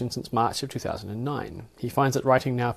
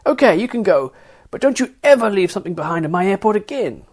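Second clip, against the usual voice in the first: high, loud warning; shouted; 8 semitones higher, 8 dB louder than usual. Acted. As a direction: Carlene shouts the release from forty feet away, loud.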